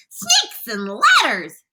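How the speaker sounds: slow, drawn-out exclamation; yell. A narrator's reading. Fire chief sees Miss Spider, yelling in dismay. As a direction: draw the oath out slowly in alarm. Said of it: "yelled the head of the fire department".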